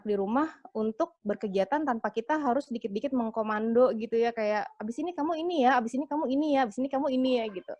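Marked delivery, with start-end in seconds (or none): 4.8-7.5 higher-pitched mock commanding parent; imitated parent directing the kids; nagging parent instructions. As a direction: rattle off reminders in a raised, sing-song pitch. Parent's repeated commands, quoted.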